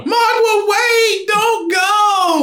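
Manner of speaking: high, drawn-out call after a runaway pet; hammed-up pleading call, long vowels; mock desperate calling. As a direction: cry out in pretend panic. Acts calling after runaway rat.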